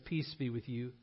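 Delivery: hushed, low greeting of peace; soft, solemn blessing; gentle blessing. Acted. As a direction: say it very softly and low. Voicing Jesus greeting the disciples.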